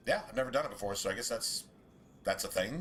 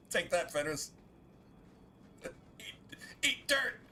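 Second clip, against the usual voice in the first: gleeful taunt; 5 semitones higher, slower than usual. Acted. Taunting a game character.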